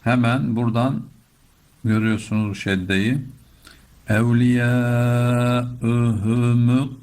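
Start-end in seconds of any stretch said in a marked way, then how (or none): 4.1-6.9 melodic tajweed chant; Quran recitation. Shown as a demonstration. Teacher chanting the line again for the class. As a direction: chant the verse, vowels held.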